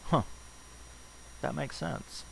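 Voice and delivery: soft, high, hushed dawning "huh"; sudden realization. Retold or imitated. Listener suddenly grasping the point.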